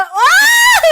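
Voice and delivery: sudden high, loud shriek; startled scream. Real, not acted. Co-host startled while magnifying the screen, high scream. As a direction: yelp out in surprise.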